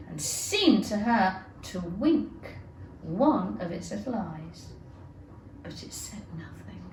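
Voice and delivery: plain reading voice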